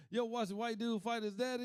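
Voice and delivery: usual speaking voice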